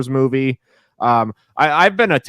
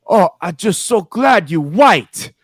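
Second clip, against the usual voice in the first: slow, higher imitation of an old Chinese woman's broken English; 6 semitones higher, 4 dB louder, slower than usual. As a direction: slowly mimic an elderly Chinese grandmother's broken English. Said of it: "she says in her broken English".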